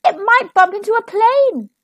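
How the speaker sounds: high, worried storybook voice; anxious child calling out; alarmed cry. A narrator's reading. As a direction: warn them in rising panic. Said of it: "cried Wilf".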